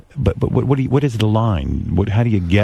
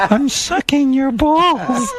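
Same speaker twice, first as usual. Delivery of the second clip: high, slow falsetto; drawn-out high squeal of mock compliance; mock phone-sex partner; 14 semitones higher, much slower than usual. Plays the partner in role play.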